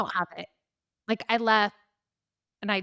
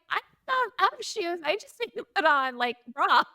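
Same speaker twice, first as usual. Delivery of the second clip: flustered panic; 8 semitones higher than usual. Retold or imitated. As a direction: sound half-asleep and thrown into alarm. Protesting while being rushed out.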